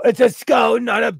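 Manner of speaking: insistent repeat of It's a skull. Shown as a demonstration. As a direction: push It's a skull up in pitch and volume.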